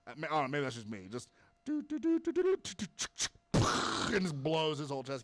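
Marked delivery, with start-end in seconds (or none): 1.1-3.6 rising high do-do-do gunshot noise; rapid-fire imitated shotgun blasts; mock gunfire sounds.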